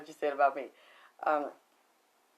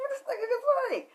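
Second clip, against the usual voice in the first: imitating a fan's high-pitched disbelief; squeaky retold amazement, way up high; amazed outburst. Retells the fan's amazed reaction.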